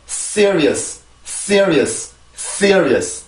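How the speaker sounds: "Serious" as crisp English, strong s, stressed first syllable; English pronunciation demo. Shown as a demonstration. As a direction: say "Serious" English-style in three quick syllables, falling. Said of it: "한 번 더". (영어식 "Serious" 발음 시범)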